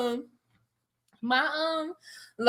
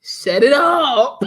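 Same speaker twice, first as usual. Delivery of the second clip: laughing; 8 semitones higher, 8 dB louder, much slower than usual. Real, not acted. Laughing, telling friend to hush.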